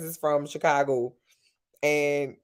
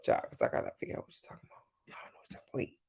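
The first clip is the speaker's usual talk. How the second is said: barely voiced, reading the chat under her breath; murmuring to herself; 14 dB quieter, much faster than usual. Real, not acted. Reading a viewer's comment to herself, a barely voiced murmur. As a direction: mutter it to yourself while scrolling back.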